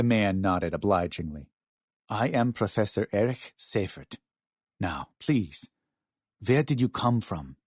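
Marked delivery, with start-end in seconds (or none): 2.1-7.6 put-on German accent. A narrator's reading. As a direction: introduce yourself gently with a German accent. German professor introduces himself to strangers.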